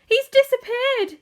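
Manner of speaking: very high, loud, drawn-out cry; panicked yell. A narrator's reading. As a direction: yell in horror at his vanishing. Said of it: "yelled Missus Gloop".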